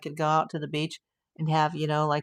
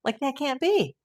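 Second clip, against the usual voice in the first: high, startled mock disbelief; a jump up in pitch at What, then incredulous; retold disbelief; 7 semitones higher, slower than usual. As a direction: replay the moment you couldn't believe the result. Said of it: "and I was like".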